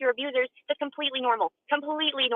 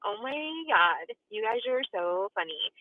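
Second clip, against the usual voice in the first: sarcastic; 4 dB quieter, slower than usual. Real, not acted. Sarcastic reply to the chat.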